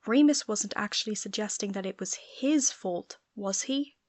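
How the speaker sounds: ordinary delivery, nothing marked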